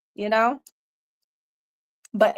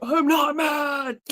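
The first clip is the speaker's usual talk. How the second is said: put-on defensive boyfriend; 5 semitones higher, slower than usual. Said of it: "He's like".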